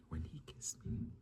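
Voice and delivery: slow, hushed whisper. Real, not acted. Telling viewers a secret, whispered. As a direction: lean in and whisper it like gossip. Confiding to viewers about the kiss.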